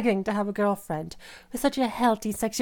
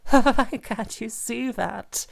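normal talking voice

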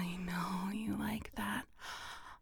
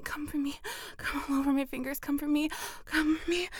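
building urgency; 6 semitones higher, faster than usual. Acted. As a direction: push harder each time, climbing. Urging partner to climax.

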